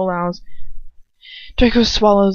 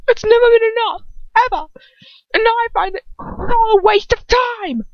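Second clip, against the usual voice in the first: much higher, louder, crying out; near tears; 5 dB louder than usual. Acted. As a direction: break into a tearful cry. Draco near tears at father.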